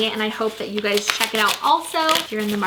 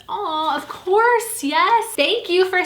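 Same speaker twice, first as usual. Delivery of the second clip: high, loud, slow thrilled yes; shrieking jackpot winner; 8 semitones higher, 3 dB louder, slower than usual. Real, not acted. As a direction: squeal like you just won. Agreeing to share the book.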